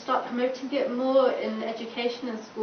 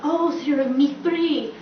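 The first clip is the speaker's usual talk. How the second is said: higher, louder drawn-out imitation; mocking label imitation; 4 semitones higher, 6 dB louder, slower than usual. Others labelling the speaker, drawn-out mocking imitation. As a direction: imitate them, stretching the label out loudly. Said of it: "they say it in this way".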